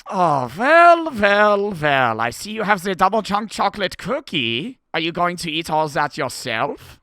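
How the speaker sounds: loud, high, hammed-up German accent; Hans, German accent. Host doing his requested Hans bit, exaggerated German accent. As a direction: boom it out as a cartoon German, relishing each word. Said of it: "The Hans accent?".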